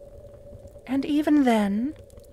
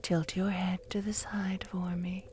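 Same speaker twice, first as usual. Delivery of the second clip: whisper; 2 semitones lower, 7 dB quieter than usual. Acted.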